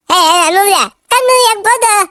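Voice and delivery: put-on high, scolding old woman; angry granny. Granny scolding the young driver, high and cross. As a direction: scold like a cranky grandma. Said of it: "si nenek pun jadi marah dan ikut ngomel".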